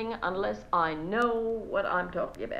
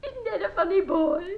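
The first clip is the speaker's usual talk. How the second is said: much higher, cooing gush; 10 semitones higher than usual. Acted. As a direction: coo it proudly about the boy. Repeats praise of son.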